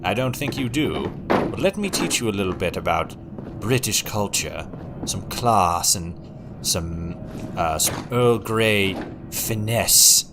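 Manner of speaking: measured Kingsman-style posh English; sophisticated British accent. Shown as a demonstration. Streamer mock-teaching a viewer British culture, sophisticated British accent. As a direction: lecture like a refined British gentleman. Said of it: "the sophisticated British accent".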